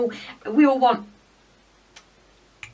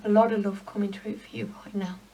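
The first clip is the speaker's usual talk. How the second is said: breathy whispered words, fighting tears; real emotion sinking into a whisper; choked whisper; 3 dB quieter, faster than usual. Real, not acted. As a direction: whisper it, too moved to voice it. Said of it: "Sorry, I got choked up then".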